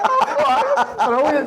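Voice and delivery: high-pitched, helpless laughing reaction; laughing delight. Real, not acted. Teller recalling his prank coming back at him, laughing. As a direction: crack up as you say it.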